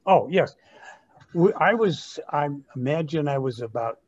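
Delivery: ordinary talk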